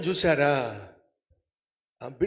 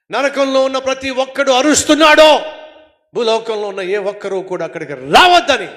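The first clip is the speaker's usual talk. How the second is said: loud, driving sermon voice, raised all through; fiery preaching; 11 semitones higher, 13 dB louder than usual. Acted. Preacher warning the congregation about hell, full-force preaching.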